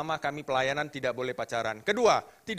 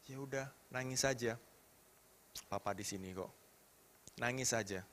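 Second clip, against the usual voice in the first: hushed, low comforting voice; tender reassurance; 4 semitones lower, 10 dB quieter than usual. Retold or imitated. Father holding his crying child, tender and hushed. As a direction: softly give permission to cry.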